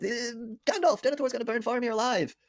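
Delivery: very high, frantic mock cry for help; panicked Pippin. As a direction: imitate a small hobbit calling out in panic. Acts panicked Pippin calling Gandalf.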